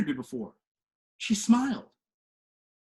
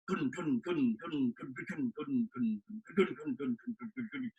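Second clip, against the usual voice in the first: putter like an idling car; quiet engine idle; 8 dB quieter, slower than usual. Retold or imitated.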